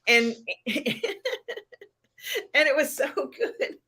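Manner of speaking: laughing through the words. Real, not acted. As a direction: crack up while talking. Laughing at the scene.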